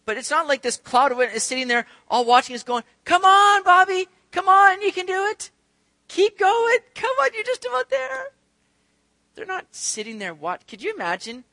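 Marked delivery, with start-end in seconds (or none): 3.0-8.3 high, loud mock cheer from the stands; hammed-up cheering spectator; cheering crowd. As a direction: cheer him on like a sports fan.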